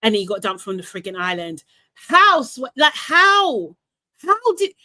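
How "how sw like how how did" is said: exasperated how. Real, not acted. Disbelief at the dumping.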